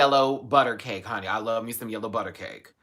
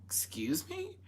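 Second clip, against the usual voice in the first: surprised; 11 dB quieter, slower than usual. Retold or imitated. Shocked at wand price.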